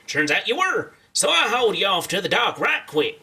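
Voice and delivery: folksy cowboy storyteller. Acted. Game character explaining a rescue to the player, cowboy voice. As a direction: tell it like an amiable old-west cowpoke.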